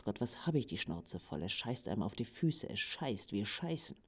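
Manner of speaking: quiet, weary, fed-up muttering; muttered disgust. Acted. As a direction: mutter it under your breath in disgust. Disgusted rant about Russia.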